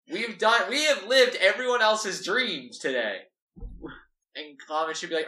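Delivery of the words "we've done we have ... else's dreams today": high, loud, triumphant; high thrilled bragging; excited Doll. Acted. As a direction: crow about the heist. Doll brags after the heist.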